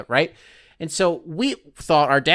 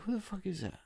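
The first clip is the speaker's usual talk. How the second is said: hushed aside; 6 semitones higher, 16 dB quieter than usual. Retold or imitated. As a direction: murmur it half to yourself while staring. Staring at a stranger in store.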